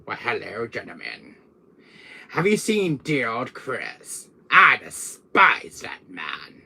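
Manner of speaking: slow, theatrical villain with swooping pitch; drawn-out, haughty villain impression; Wesker. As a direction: relish each word with villainous disdain. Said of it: "Sometimes I'll get Wesker in here".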